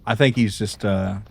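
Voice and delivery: usual speaking voice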